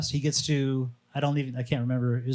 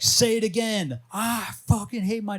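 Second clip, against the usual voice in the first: imitated screaming; 9 semitones higher than usual. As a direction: push the words out in a shout. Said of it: "screaming it over and over".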